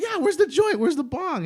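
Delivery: high, loud, excited demand; eager retold reply. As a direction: act out being raring to get high. Acting eager reply to friend's offer.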